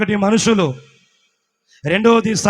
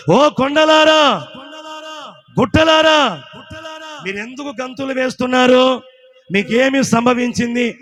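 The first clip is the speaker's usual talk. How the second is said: slow, louder ringing call to the hills; preaching call; 3 semitones higher, 4 dB louder, slower than usual. Acted. Preacher voices hills' question. (కొండలను పిలిచే బోధ స్వరం)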